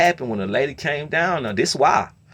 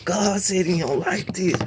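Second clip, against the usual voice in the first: mock whine; 8 semitones higher, slower than usual. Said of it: "kept talking about was God".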